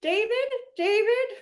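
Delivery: mocking high call, rising sharply; Shannon Beador impression. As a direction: call David in a mock high whine. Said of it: "she's a little Shannon Beador".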